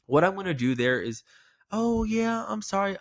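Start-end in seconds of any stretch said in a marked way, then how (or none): 1.7-3.0 high, light apologetic play; playful. Shown as a demonstration. Agent answering the angry caller, high and playful. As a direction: brush it off with a grin. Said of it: "the playful tone that I use most often".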